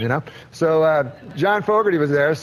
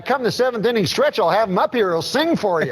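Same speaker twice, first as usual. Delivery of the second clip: put-on high, chummy old ballplayer voice; Uecker impression; 8 semitones higher than usual. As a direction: pitch it up like a folksy baseball announcer. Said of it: "I just love those Euchre impressions".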